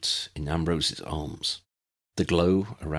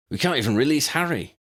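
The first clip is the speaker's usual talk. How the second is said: a hint of frustrated Ron, quicker; lightly voiced Ron; 8 semitones higher, 4 dB louder, faster than usual. A narrator's reading. Ron complains they cannot free Harry, lightly voiced. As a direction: push it out fast and frustrated.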